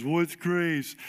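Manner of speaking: imitating a tearful little kid; lightly mimicked crying child; mock sobbing. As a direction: whine it like a child mid-meltdown. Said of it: "you just tears and sobbing".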